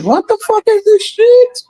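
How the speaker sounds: high, fed-up disbelief; exasperated outburst. Retold or imitated. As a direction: throw up your hands in disbelief. Reacting to boring game, I'm like.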